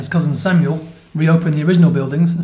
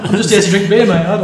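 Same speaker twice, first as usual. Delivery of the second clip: laughing through a mock reply; a cheeky blokey line, half laughing; 4 semitones higher, 7 dB louder, faster than usual.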